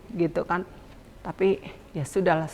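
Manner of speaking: ordinary delivery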